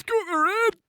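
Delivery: high, strained imitation of a Geordie shout; mock Geordie yell. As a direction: mimic the frantic Geordie's last cry. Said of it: "The last thing I hear is".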